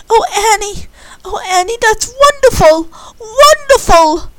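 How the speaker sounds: high, rising to loud joyful cries; overjoyed. Acted. Candy hearing Annie's good news, building to loud joy. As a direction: burst out with joy.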